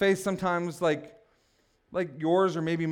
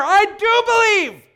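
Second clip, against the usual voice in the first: high, loud, drawn-out cry of faith; passionate scream; 14 semitones higher, 9 dB louder, slower than usual. Acted. Preacher acts the desperate father exclaiming, passionate scream. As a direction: scream it out slowly with full passion. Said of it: "there's some passion there".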